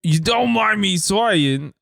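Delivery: put-on slow, careful Cockney accent; Cockney raccoon. Practising the comic line as Rocket, halting Cockney. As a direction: feel your way through the line in Cockney. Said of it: "As Rocket Cockney Raccoon".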